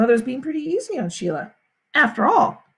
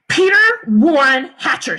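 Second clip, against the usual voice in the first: loud, measured full-name reprimand; stern scolding; 3 semitones higher, 8 dB louder than usual. A narrator's reading. Mother confronting her son in the kitchen, loud stern scolding. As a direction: punch each name sternly.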